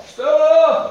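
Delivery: sudden high delighted cry of the name; light up at the name; excited exclamation. Real, not acted. Echoes the volunteer's name.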